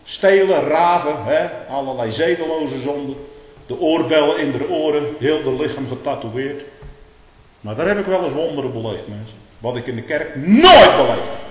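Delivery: loud, rising pulpit preaching; preaching at full force. Acted. Preacher raising his voice against the world. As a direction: thunder it from the pulpit.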